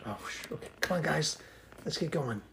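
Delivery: hushed whisper while sneaking; quiet at night. Pretend. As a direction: tiptoe past, barely voiced. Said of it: "You don't need to tiptoe".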